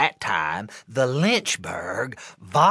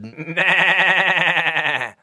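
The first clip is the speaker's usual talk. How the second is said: higher bleating goat voice; billy goat bleat; 6 semitones higher than usual. Acted. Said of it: "shook his head and billowed".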